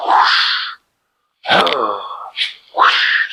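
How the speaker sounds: breathy whoosh like Superman flying; whooshing sound. Shown as a demonstration. Coach showing the flying move, a breathy whoosh. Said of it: "Superman laying down, like".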